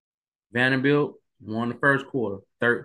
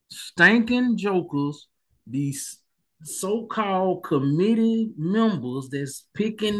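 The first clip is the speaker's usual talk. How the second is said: exasperated rant; 8 semitones higher, slower than usual. Real, not acted. Vlogger fuming at the playoff committee, exasperated. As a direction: vent at those so-called committee members.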